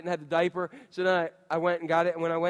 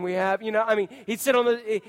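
ordinary talk

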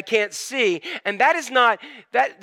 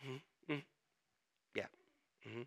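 very soft, low closed-mouth hums; tight-lipped, barely voiced acknowledgements; angry but silent; 8 semitones lower, 25 dB quieter, faster than usual. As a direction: hold the anger in, with long pauses. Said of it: "when you're angry, you're just like".

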